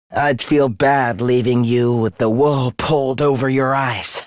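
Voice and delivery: low, subdued concession; defeated, spent. Acted. Beaten villain admitting loss to foes, low and drained. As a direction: let the voice sink, all fight gone.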